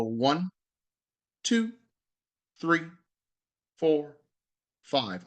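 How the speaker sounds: slow, spaced count with long pauses; slow counted breath. Shown as a demonstration. Yoga teacher demonstrating the count to the class, slow and spaced. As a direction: count each number slowly, leaving room to breathe. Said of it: "So it's gonna sound like this".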